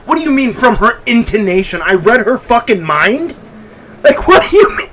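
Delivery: can't believe what the game said; incredulous. Real, not acted.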